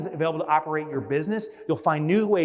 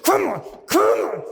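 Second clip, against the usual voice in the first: mock straining; 4 semitones higher, 5 dB louder than usual. Straining to squeeze tube.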